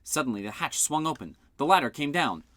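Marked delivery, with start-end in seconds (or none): none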